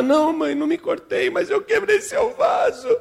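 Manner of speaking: put-on high-pitched childish crying voice; sobbing little girl. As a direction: confess through hammed-up sobs. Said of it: "a menina chorando".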